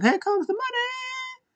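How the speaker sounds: very high, slow sung-out line; mock entrance song. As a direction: croon the entrance theme mockingly. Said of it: "Shane O'Mac".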